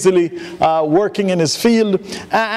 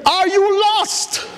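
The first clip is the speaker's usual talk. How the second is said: much higher, slow, pleading question; earnest young preacher; 11 semitones higher, slower than usual. Retold or imitated. Young preacher pressing the farmer, high and slow. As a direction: ask it like a worried rookie evangelist.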